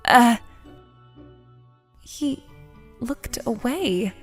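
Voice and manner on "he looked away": soft, slow, deflated realization; hushed and halting; dejected. Acted. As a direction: let it sink in quietly. Dejected narration of being avoided.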